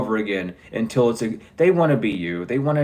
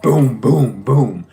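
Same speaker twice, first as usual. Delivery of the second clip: imitated banging; 7 dB louder, much slower than usual.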